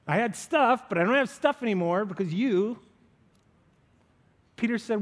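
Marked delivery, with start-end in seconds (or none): 0.1-2.8 incredulous. Retold or imitated. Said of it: "I think incredulously".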